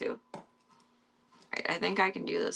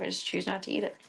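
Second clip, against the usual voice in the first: usual speaking voice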